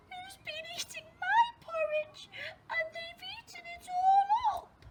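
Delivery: tiny, very high squeaky little voice; Baby Bear. Acted. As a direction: squeal it like an upset little cub. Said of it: "in a tiny little voice".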